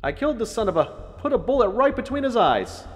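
high, loud echoing call; father shouting from afar; distant shout. A narrator's reading. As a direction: call from the other end of a bunker. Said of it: "sounded like he was at the other end".

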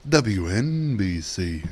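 slow, swooping station call sign; Howard Stern. Retold or imitated. Repeating a radio bit for laughs, exaggerated station ID. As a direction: stretch the letters with a big swoop. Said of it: "he was doing the".

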